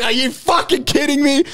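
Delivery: high, laughing incredulity; laughing disbelief. Retold or imitated. Finding the chicks stuck again, laughing and high. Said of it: "I was like".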